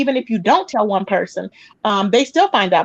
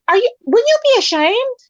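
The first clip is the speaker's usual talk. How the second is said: much higher, incredulous question; shocked disbelief; 13 semitones higher than usual. Retold or imitated. Teller retells asking the cellmate in stunned disbelief, much higher. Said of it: "I was like".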